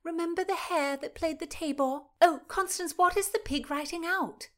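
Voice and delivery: Mary burbling with joy at the pig. Acted. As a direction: gush over the animals. Mary gushing at the show.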